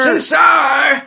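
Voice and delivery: put-on high shouting old man. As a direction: keep the crotchety grandpa yell. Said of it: "my old man voice".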